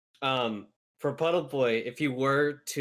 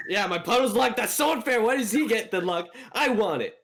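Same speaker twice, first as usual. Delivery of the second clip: high, loud whining; whiny jealous complaint; 9 semitones higher, 4 dB louder than usual. Pretend. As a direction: whine like a jealous kid. Jealous over lost water powers.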